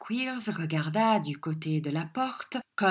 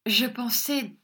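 put-on higher flustered young lady; flustered girl; 6 semitones higher than usual. A narrator's reading. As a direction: cover it up, all aflutter. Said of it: "Miss Squeers flustered reply".

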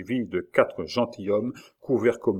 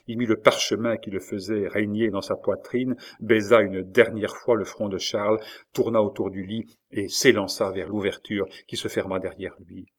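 plain reading voice

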